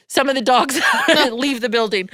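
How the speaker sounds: quoting husband with a laugh breaking in; laughing through it. Retold or imitated. Retelling husband David's dog rule, laughing mid-line. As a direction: laugh as you deliver the line. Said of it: "And he's like".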